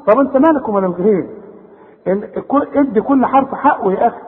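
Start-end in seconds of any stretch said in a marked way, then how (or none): none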